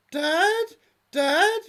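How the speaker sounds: very high, loud pleading daughter calling Dad; high girlish plea; squeaky daughter. Acted. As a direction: whine it up like a lovesick girl.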